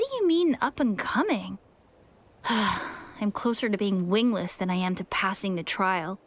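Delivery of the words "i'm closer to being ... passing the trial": low, deflated and discouraged; dejected sigh. Acted. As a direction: sigh it out, giving up on himself. Sighs about failing trial.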